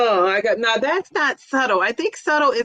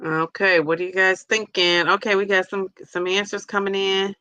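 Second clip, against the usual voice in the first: ordinary delivery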